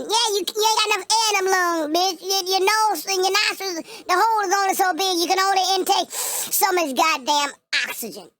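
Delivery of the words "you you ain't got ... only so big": usual speaking voice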